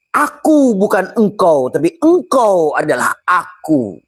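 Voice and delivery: drawn-out high voice for the glass. Acted. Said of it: "Kata gelas kaca, kepada kaca". (suara gelas kaca yang tinggi dan lambat)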